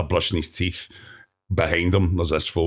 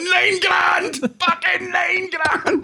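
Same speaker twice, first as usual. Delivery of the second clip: exasperated shout; much slower than usual. Retold or imitated. Pointing out the advert he lost, a high laughing anguished shout. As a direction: yell it at the telly like you've been robbed. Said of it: "I'd be like".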